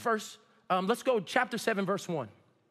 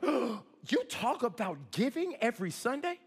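gasp, then incredulous questioning; mock shocked reporter; 3 semitones higher than usual. Reporter questioning pastor about giving every Sunday, mock shock. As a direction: imitate someone taken aback, gasping first. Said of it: "the reporter was like".

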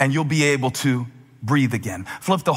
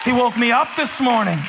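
raised, emphatic pulpit cry; fervent preaching; 7 semitones higher than usual. Real, not acted. Preacher reminding believers where they came from, fervent preaching. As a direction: call it out to the congregation in praise.

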